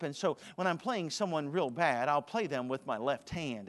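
normal talking voice

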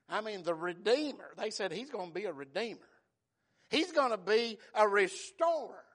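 very loud, building proclamation; emphatic preacher's shout; full-force preaching. Real, not acted. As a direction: thunder it out to the congregation, climbing at the end.

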